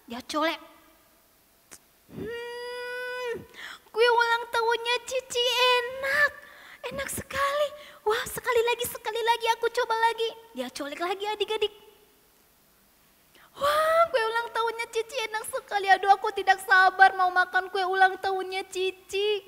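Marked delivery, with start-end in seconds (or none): 2.2-10.3 high-pitched, savouring delight; delighted little child. Acted. Keke secretly tasting the birthday cake, delighted and high. 13.6-19.4 put-on high, eager child voice; delighted, impatient child. Keke after a second taste of cake, excitedly delighted.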